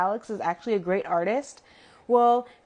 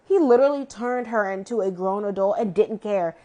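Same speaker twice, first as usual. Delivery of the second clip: usual speaking voice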